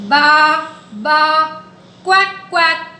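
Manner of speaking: playful animal imitations; sheep then duck sounds. Shown as a demonstration. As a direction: call out the animal noises.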